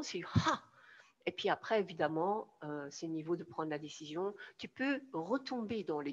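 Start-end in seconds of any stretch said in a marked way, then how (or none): 0.3-0.6 sharp, emphatic "ah"; decisive exclamation. Shown as a demonstration. Coach showing what taking a decision feels like, decisive exclamation. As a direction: burst out with a sudden breath of resolve. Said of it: "quand tu prends cette décision".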